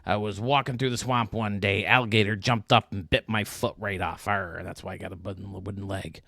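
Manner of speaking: loud arr, then lightly gruff pirate yarn; hammed-up pirate storytelling. Pirate in the joke explains his wooden leg, mild pirate voice. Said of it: "He says".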